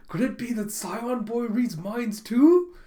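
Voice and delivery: high, loud, slow mock astonishment; shocked realization. Girl suspects the boy reads minds, shocked. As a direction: stretch it out in dawning alarm.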